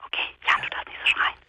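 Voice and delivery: hushed whisper; whispering so nobody wakes; whispering on the phone. Real, not acted.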